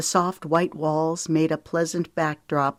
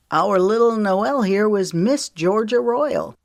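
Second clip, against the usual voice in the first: louder boastful brag; bragging; 5 semitones higher, 4 dB louder than usual. A narrator's reading. Jackson bragging to Lemon about his cousin. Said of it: "Jackson bragged to Lemon".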